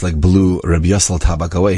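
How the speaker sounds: usual speaking voice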